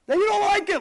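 very high, loud outburst; emphatic shout. Retold or imitated. Rabbi rebukes gabbais for their dislike, shouting. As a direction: burst out at full voice. Climax of explaining their hatred.

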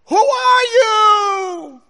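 long, high, drawn-out holler; acted scream. Kid calling across the cavern, screaming. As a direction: bellow slowly across a canyon, high and stretched. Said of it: "this kid screams across the cavern".

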